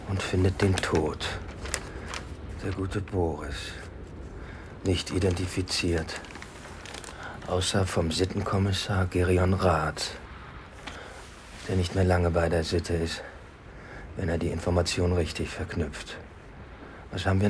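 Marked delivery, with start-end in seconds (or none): none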